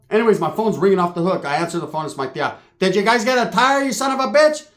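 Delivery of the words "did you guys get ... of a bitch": higher-pitched imitation of an aunt; scolding aunt. Tia phones about the tire, high mock scolding. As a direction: imitate an aunt demanding answers.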